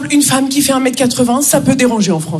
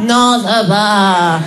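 very slow, low, dragging reply; Martine; 5 semitones lower, much slower than usual. Retold or imitated. French colleague asked how she is, glum and drawn out. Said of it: "ma collègue Martine".

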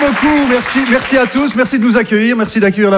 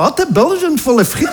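mock Belgian cliché; 5 semitones higher than usual. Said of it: "en disant".

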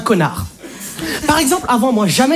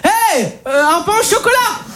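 rude, much higher bark; mock tough order; 10 semitones higher than usual. A customer at the bakery, barking a hammed-up rude order. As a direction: act like a hard man ordering bread. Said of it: "très, très méchant".

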